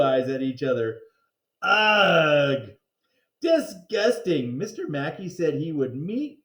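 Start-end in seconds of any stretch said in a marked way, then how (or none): 1.6-2.7 loud grossed-out groan; kid's loud disgusted ugh. Acted. Child narrator disgusted at teachers. 3.4-4.6 disgusted. Acted. Child narrator disgusted.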